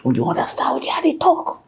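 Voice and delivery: a hushed aside to the listener; whisper. Real, not acted. Whispering to viewers to check they understand.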